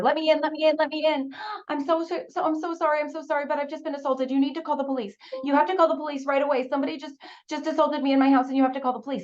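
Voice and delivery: high, fast, frantic pleading; desperate plea. Retold or imitated. Girl at the neighbor's door begging for help, frantic. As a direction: beg for help, breathless and urgent.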